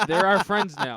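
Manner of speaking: laughing as he says it. Real, not acted. Introducing new friends to Linda, laughing. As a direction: giggle through the line. Laughing at his own typed line.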